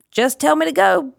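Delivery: high-pitched, eager prompting; very expectant. Acted. Therapist waiting on a child to respond, expectant. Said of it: "very expectant".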